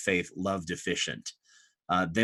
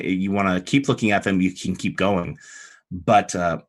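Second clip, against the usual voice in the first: ordinary delivery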